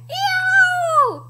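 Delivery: long high "ew" shriek; hammed-up dinosaur scream; storybook scream. A narrator's reading. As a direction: squeal it high in mock pain. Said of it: "screamed Tyrannosaurus".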